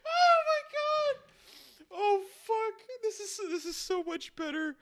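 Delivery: very high squeal through a laughing fit; helpless laughter. Real, not acted. Listener delighted by the Rasta act, laughing helplessly. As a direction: squeak the words out while cracking up.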